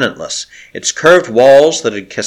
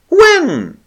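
very high stammered question; 3 dB louder than usual. Acted. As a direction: stammer it, dumbfounded. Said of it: "he stammered fatuously".

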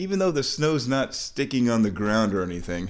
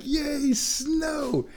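much higher squeal of delight; excited yay. Retold or imitated. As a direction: squeal about the snow like a kid. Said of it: "I was like".

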